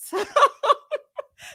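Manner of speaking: high burst of laughter. Real, not acted. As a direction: cackle out loud. Laughs at own remark.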